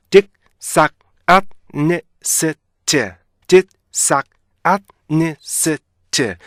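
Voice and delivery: "딕삭아니스트" broken into slow, separate stop-ended syllables; each syllable of "딕삭아니스트" alone, with pauses between. Shown as a demonstration. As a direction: chop "딕삭아니스트" into isolated beats, very slowly. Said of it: "음절 구분으로 나눠서 읽게 되면은요".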